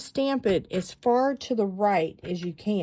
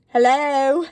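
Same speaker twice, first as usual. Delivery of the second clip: a higher, louder mock English-accent "Hello"; 5 semitones higher, 6 dB louder than usual. Squawking seagulls heard as the ad's mock English-accent hello. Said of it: "all I hear is".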